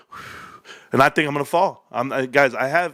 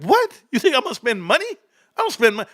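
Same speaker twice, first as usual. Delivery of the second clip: a high squeaky outraged What; mock outrage at the idea of spending; incredulous; 7 semitones higher than usual. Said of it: "I was like".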